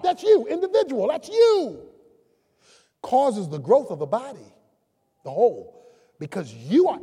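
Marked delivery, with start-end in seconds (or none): none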